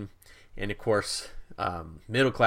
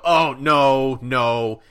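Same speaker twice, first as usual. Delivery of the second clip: pleading crowd; 3 semitones higher, 7 dB louder, much slower than usual. Retold or imitated. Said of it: "all the people on the train immediately go".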